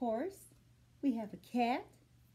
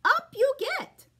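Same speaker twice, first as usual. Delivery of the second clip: much higher, louder cheery horse; cheerful horse; 13 semitones higher, 7 dB louder than usual. Acted. Horse welcomes the animals aboard, high and loud. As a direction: call it out like a jolly horse. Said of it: "says Mister Horse".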